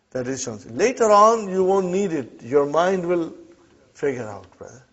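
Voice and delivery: ordinary talk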